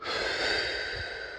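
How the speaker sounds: long audible breath, no voice; guided deep breath. Shown as a demonstration. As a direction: breathe slowly and audibly for the listener to follow. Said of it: "take a breath together".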